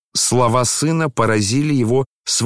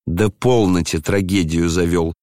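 usual speaking voice

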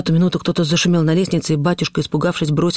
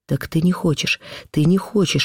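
usual speaking voice

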